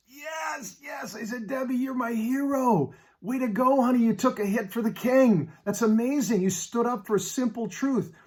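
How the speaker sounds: high, delighted cheers for his wife; elated cheering. Retold or imitated. Husband cheering his wife after her hard night, elated. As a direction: cheer her on like she won.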